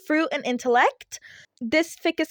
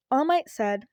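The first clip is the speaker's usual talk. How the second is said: ordinary delivery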